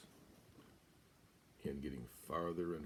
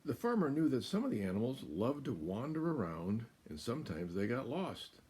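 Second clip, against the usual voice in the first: put-on lively storybook narration to a child; reading to children; 4 semitones higher, 5 dB louder than usual. A narrator's reading. Parent reading a farm story aloud to a child, animated. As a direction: read it like a bedtime picture book.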